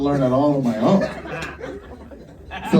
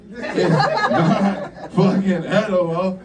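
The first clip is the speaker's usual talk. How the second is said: ordinary delivery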